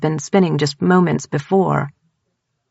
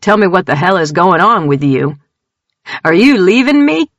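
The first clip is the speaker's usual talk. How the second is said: loud, rising frustrated plea; angry demand; 5 dB louder than usual. A narrator's reading. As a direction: push him to the edge of shouting.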